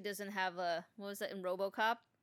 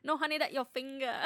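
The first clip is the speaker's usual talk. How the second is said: comic character voice; 6 semitones higher, 4 dB louder than usual. Pretend. Joins the character voice.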